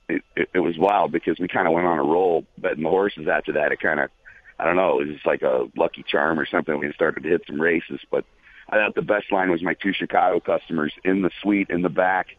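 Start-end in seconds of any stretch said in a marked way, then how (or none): none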